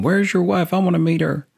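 Southern twang on "Where's your wife? I wanna meet her."; mock Southern. Husband retelling a neighbor with a Southern twang. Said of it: "I like how you put that Southern".

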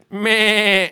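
high bleating goat. Pretend. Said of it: "Identical, wow".

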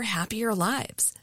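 ordinary talk

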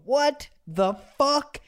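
drawn-out gross-out; mock horror, slow; slow disgusted retold question. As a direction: stretch it out, repulsed. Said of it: "I go".

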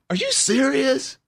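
slow, high-pitched disbelieving question; incredulous. Retold or imitated. Retelling her reply to the proposal, incredulous. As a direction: stretch it out high, as if stunned.

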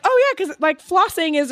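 very high, breezy agreement; mock naive oh yeah. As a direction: chirp it up high as if it's easy. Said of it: "I was like".

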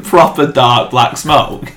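ordinary delivery

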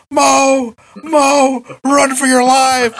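high, drawn-out cry of alarm; mock panicked warning. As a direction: imitate calling to a friend while fleeing. Retold fleeing man calls a warning.

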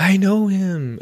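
high, thrilled "I know him"; excited elf. Retold or imitated. Buddy brags to the store crowd, high and thrilled. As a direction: burst with childlike pride. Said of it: "He's like".

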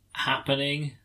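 normal talking voice

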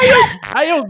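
laughing retelling. Real, not acted.

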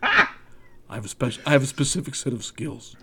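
low quiet growl, hint of Neeson; quiet menacing action hero; Liam Neeson impression. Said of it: "What did, uh, Liam Neeson say?".

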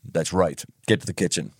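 low quiet command; stern dad. Retold or imitated. Dad ordering his son to the kitchen, low and quiet. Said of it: "He said".

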